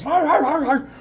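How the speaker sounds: high, loud barked words; hammed-up attack dog voice; barking dog. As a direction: talk in barks as an aggressive dog. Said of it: "the dog the whole time was".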